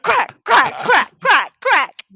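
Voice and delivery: high, slow, loud quacking; duck voice. Pretend. Duck quacking after Mighty Ducks.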